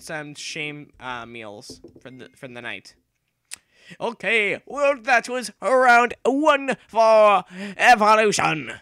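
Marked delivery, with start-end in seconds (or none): 4.0-8.7 loud, slow, drawn-out declaration; mock announcer bellow; announcer shout. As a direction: call it out like a ring announcer. Announcing end of round.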